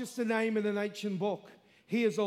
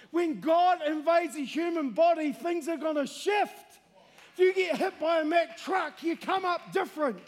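loud, high preaching; full force preaching; 6 semitones higher, 5 dB louder than usual. Real, not acted. Preacher driving the point home to the crowd, loud preaching.

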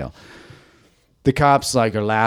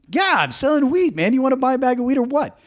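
mock tough dealer. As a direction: fake the street dealer to stay cool. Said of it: "I'm like".

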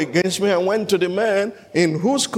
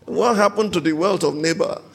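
ordinary delivery

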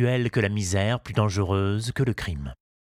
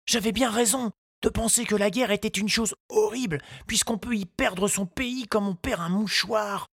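put-on high, polite little boy's voice; a small boy asking politely; young boy Tistou; 12 semitones higher than usual. A narrator's reading. Tistou asks the gardener, high child voice.

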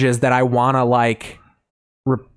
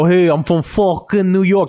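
higher, hammed-up New York accent; 5 semitones higher than usual. Mocking the East Coast impression, hammed-up New York accent. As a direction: put on a brash mock New Yorker. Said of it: "or you get the".

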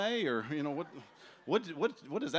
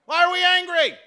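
loud, high, heated back-and-forth; mock angry yelling; 13 semitones higher, 11 dB louder than usual. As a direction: throw the anger right back, shouting. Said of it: "You just mirror the emotion".

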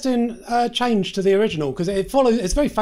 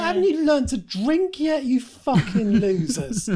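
high, drawn-out exasperated jeer; mock scolding; 8 semitones higher, slower than usual. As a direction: berate them slowly in a high, incredulous voice. Mock scolding of partying twenty-somethings.

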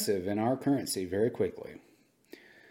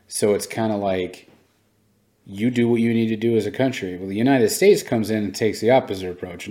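ordinary talk, nothing marked

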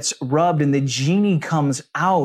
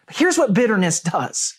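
ordinary delivery

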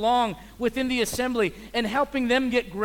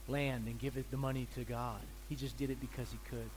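soft, low, sincere sermon voice; quiet earnest preaching; 6 semitones lower, 9 dB quieter than usual. Real, not acted. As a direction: bring it down and speak tenderly.

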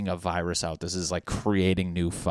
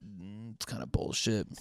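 quiet grumble; 2 semitones higher, 7 dB quieter than usual. Retold or imitated. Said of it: "I'm like".

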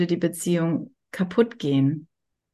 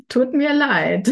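slow, giggly, higher and louder; laughing apology; 6 semitones higher, 5 dB louder, slower than usual. Real, not acted. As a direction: say sorry through laughter.

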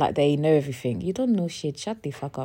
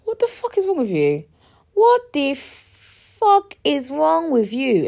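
drawn-out indignant swearing, slower; slow, stretch each word in disbelief; mock outraged; 8 semitones higher, 4 dB louder, slower than usual. Answering her rude partner with slow disbelieving anger. Retelling her angry reply.

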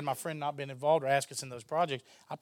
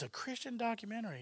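high, softer note of surprise; retold intrigued reaction; 9 semitones higher, 6 dB quieter than usual. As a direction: react with mild surprise, pitched up and soft. Acts out director's intrigued reaction.

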